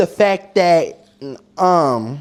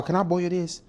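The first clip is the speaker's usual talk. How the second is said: ordinary delivery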